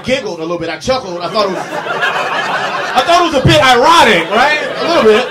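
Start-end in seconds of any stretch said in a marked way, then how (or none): 1.2-5.3 real laughter breaking through; laughing. Real, not acted. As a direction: crack up while admitting it. Laughing at irony.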